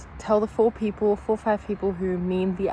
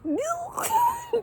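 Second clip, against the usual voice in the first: a high, teary burst of laughing; overwhelmed laugh-cry. Real, not acted. Woman overwhelmed by joy over her life, laughing through tears. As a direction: laugh and half-sob from sheer joy. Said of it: "I'm just so overwhelmed with just".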